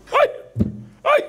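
short high moan of pain; demonstrated pained groans; sick person's groan. Shown as a demonstration. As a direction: yelp like someone ill and hurting. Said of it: "כמו חולה".

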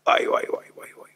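breathless whispered wow; awed whisper. Acted. Preacher marvelling before the crowd, awed whisper. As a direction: hush it out in awe.

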